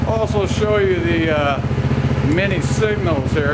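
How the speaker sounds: talking up over a running engine. Real, not acted.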